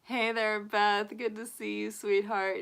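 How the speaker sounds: ordinary talk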